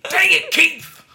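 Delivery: very high, loud squealed scolding; mock exasperated yell. Host scolding imaginary Keith after a slip, laughing mock yell.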